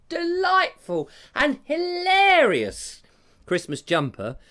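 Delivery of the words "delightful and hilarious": high, drawn-out mock rapture; hammed-up gushing. Praising Julia's Christmas jumper, hammed-up gushing. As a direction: swoop up high and stretch each adjective.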